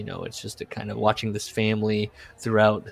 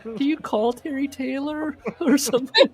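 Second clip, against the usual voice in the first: higher mock wife voice, pushing; wife asking husband about his book, higher mock voice; impatient wife. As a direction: nag him gently about the book in a higher voice. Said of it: "And she's like".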